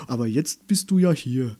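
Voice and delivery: plain reading voice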